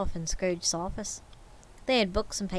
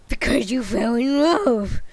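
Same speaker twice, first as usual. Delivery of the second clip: slow, louder growled sneer; mocking growl; 8 dB louder than usual. Scrooge sneering at nephew's marriage, slow growl. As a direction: drag it out with scorn. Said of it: "growled Scrooge".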